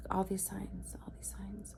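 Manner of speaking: hushed, trailing whisper. Real, not acted. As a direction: whisper it as an eerie aside, fading away. Hushed aside about eerie signs.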